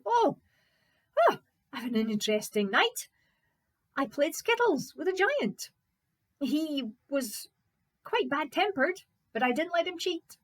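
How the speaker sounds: high, loud, lively hero voice; undaunted Fritz, pleased. Acted. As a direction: recount the night's adventure with gusto. Fritz reports to servant.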